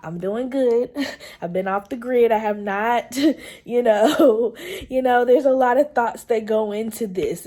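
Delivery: loud laughter spilling into the words; laughing. Real, not acted. Mother-to-be laughing loudly while telling viewers she's been off the grid. Laughing through her vlog update.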